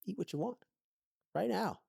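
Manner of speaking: high, sing-song tempting little voice; coaxing inner child voice. Retold or imitated. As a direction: coax like a mischievous kid inside your head.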